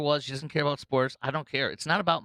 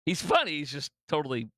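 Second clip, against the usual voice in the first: ordinary delivery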